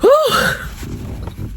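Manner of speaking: breathy high exhale after crying; tearful whew. Real, not acted. Mother calming herself after crying, a high breathy whew. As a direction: let out a shaky relieved whew. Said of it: "that little ugly cry".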